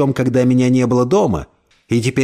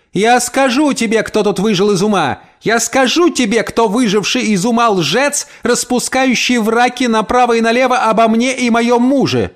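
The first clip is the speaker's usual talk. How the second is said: high, shrill furious yelling; shouting from the stairs; 8 semitones higher than usual. Acted. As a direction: scream it down the staircase. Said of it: "теперь кричала со ступенек". (хозяйка кричит со ступенек, высоко и яростно)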